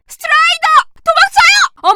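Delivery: high, loud panicked yell; terrified scream. Acted. Citizen screaming that a strider is coming, terrified. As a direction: scream the warning and flee.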